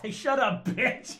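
high, loud order breaking into laughter; hammed-up aggressive telling-off; mock bullying. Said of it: "You say it in, like, her mouth".

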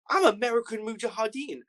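first telling, voice pushed high; imitating the American jihadi. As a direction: play him big and high, chest out. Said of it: "And he goes".

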